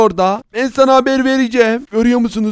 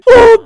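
very high, loud oh; cartoon squeal at the gifts; delighted gasp; 12 semitones higher, 8 dB louder than usual. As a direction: gasp in exaggerated joy. Sees all the presents.

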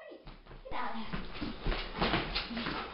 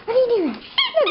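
high-pitched surprise; 8 semitones higher than usual. Real, not acted. Surprised at the dog.

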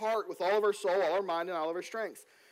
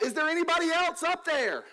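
higher hammed-up shout up the cliff; mock shout; 8 semitones higher than usual. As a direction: desperately call for someone else, for the punchline. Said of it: "and then he shouts".

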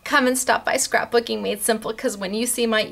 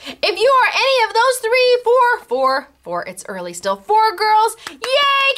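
excited announcing; 9 semitones higher than usual. Real, not acted. Announcing giveaway winners.

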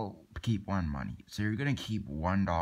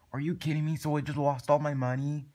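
higher-pitched, disbelieving complaint; indignant dismay; 6 semitones higher than usual. Acted. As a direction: protest being cheated, voice lifted. Acted dismay at lost money.

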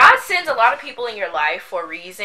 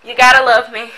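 said through a laugh, louder; 8 dB louder than usual. Real, not acted. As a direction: tease them with a laugh. Playful self-praise, laughing.

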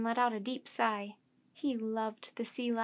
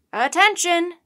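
high, very loud call to attention; commanding shout; captain's shout; 7 semitones higher, 12 dB louder than usual. A narrator's reading. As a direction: shout it out to the whole deck. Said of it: "the captain shouted".

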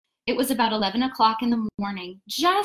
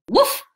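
loud high grunt on landing; oof of impact; 11 semitones higher, 7 dB louder than usual. A narrator's reading. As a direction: grunt as if hitting a bush. Crashing into a prickly bush.

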